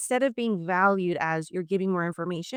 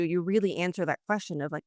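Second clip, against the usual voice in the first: ordinary talk, nothing marked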